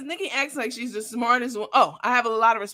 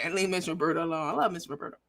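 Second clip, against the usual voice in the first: normal talking voice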